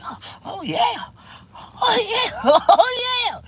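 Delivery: high cries building to loud wails; mock ecstatic hollering; exaggerated ecstasy. Acts out his pleasure.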